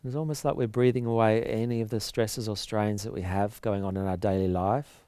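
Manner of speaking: calm breathing-count voice with long pauses; soft, measured yoga meditation delivery; guided relaxation. Real, not acted.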